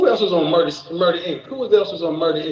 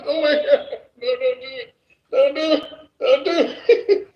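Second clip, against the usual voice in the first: higher, laughing, don't do it; giggly mock pleading; laughing protest; 7 semitones higher than usual. Real, not acted. Begging, rising, laughing.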